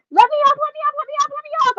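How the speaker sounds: very high frantic begging; shrill child pleading to get off the ride; panicked pleading. Retold or imitated. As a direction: beg to be let off, terrified. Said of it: "I start screaming".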